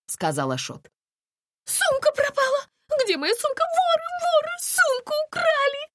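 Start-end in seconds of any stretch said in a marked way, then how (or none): 1.6-5.8 very high hysterical shriek; panicked, piercingly high yelling; wailing. A narrator's reading. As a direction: cry thief in a frantic high voice. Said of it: "завопила Настя".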